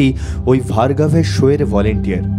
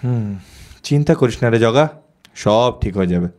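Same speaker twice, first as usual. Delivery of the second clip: low, reassuring friend's voice; soft voice; 5 semitones lower than usual. Acted. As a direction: comfort him gently, keeping the pitch low. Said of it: "নরম গলায় বলল". (নরম গলায় সান্ত্বনা)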